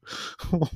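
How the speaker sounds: breathy high-pitched giggle; can't hold in the laugh. Real, not acted. Laughing at a funny game clip, a high breathless giggle.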